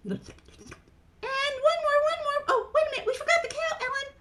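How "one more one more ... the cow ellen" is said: high, loud puppet voice; flustered tiny character blurting out; Mimi. Acted. Mimi tells the storyteller of the forgotten cow, high puppet voice.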